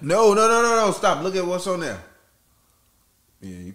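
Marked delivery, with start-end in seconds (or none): 0.0-2.0 high, urgent no no no; alarmed. Real, not acted. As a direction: stop them in sudden panic. Alarm at a spill.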